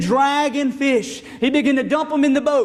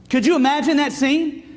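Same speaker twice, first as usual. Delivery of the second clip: ordinary talk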